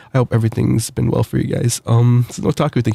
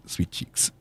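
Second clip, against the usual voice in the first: whispered tease; 14 dB quieter than usual. Acted. Teasing a fan's nickname.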